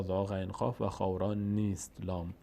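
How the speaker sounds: normal talking voice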